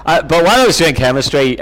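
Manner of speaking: ordinary talk, nothing marked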